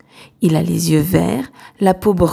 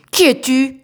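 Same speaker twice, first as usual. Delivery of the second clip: roaring demand; 6 semitones higher than usual. A narrator's reading. Bearded pirate roars at the castaway, booming. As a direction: roar the question down at the stowaway. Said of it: "se penche sur Plume et rugit".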